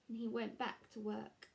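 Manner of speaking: plain reading voice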